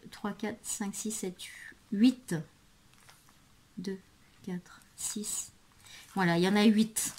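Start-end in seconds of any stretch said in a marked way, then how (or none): 0.1-1.4 much softer, near-whispered count; counting under her breath. Real, not acted. Vlogger counting pieces for herself, under her breath. 3.8-5.5 whispered, very soft count; counting under her breath. Real, not acted. Vlogger double-checking the number of pieces, whispering.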